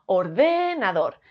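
"ordenador" with long, held DE; or-DÉÉ-na-dor, slow; stress on second syllable. Shown as a demonstration. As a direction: stretch DE in "ordenador". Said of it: "Si fuese de".

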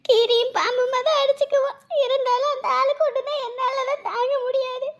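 high-pitched sobbing lament; crying. Acted. Wife confessing she cannot bear his illness, crying. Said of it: "இப்ப எதுக்கு அழுதுட்டு இருக்க".

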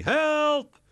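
very high, loud cry for help; shouting for help. A narrator's reading. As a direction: yell help at the top of the voice. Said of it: "schreeuwt Charlie". (luid hulpgeroep, heel hoog)

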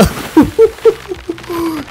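a high cackling laugh at the hit; high burst of laughter. Real, not acted. Gamer laughing after whacking the zebra, high cackle.

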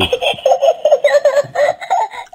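very high giggling; squeaky burst of giggles; children's laughter. A narrator's reading. Children laugh at the beach, giggling.

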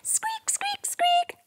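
very high, soft squeaky mouse; tiny high squeaks. Acted. Matilda squeaks.

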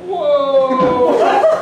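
laughing high whoa; loud delighted gasp of surprise; surprised whoa. Real, not acted. Reacting to review.

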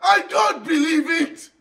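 high, loud cries of disbelief; slow, loud wail over losing; dismayed shouting. Real, not acted. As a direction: holler your defeat in protest. Disbelief at dying in game.

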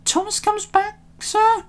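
high-pitched engine voice; engine character voices. A narrator's reading. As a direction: speak up high to the boss. Engines speaking to the controller.

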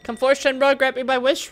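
high, impassioned cartoon speech; rallying game character.

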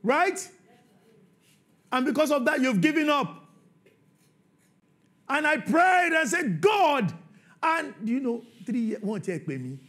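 high, loud sermon delivery; preaching at full force. Acted. Preacher stirring the church about Jesus' command, shouting. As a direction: hammer the point to the congregation.

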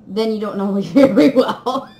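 giggling through the end; laughing. Real, not acted. Teasing viewers who can't guess, laughing. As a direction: break into laughter.

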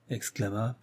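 ordinary delivery

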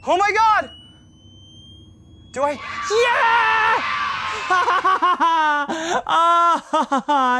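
high laughing shout; excited shout. Real, not acted.

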